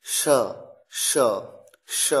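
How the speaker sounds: श repeated as a short, breathy hiss; sh sound demo. Shown as a demonstration. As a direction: model श cleanly for the class. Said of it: "ये देखिए, इस तरह का sound है".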